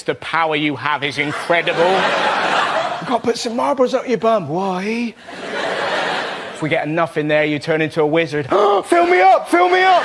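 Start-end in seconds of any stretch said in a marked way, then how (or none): 1.8-8.5 slow, sly, giggly voice; slow, sneaky persuasion to a little brother; coaxing older brother. Retold or imitated. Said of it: "eight and brother six". 8.5-10.0 high, loud, excited demand; high, loud child crying fill me up; eager little brother. Retold or imitated.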